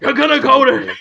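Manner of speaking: loud, gruff bad Pacino hoo-ha; Al Pacino impression. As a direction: bark it like a failing Pacino. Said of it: "I can't do him at all".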